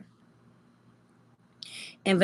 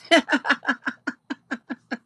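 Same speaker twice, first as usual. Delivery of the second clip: laugh; 5 semitones higher than usual. Real, not acted. Laughs at comment.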